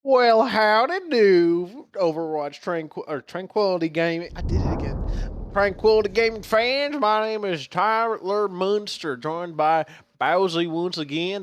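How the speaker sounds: high hammed-up southern howdy accent; put-on accent. Caster greeting fans in a put-on southern accent. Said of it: "This is not my usual accent".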